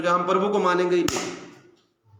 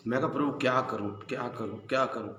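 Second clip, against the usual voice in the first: quiet despair; 6 semitones lower, 8 dB quieter than usual. Retold or imitated. Retelling praying in despair.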